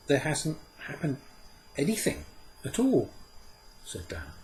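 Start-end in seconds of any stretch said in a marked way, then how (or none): 0.1-3.1 put-on high boy's voice, rising in protest; a boy grumbling that nothing happened; complaining boy. Dan complains to Puck, high protesting boy's voice.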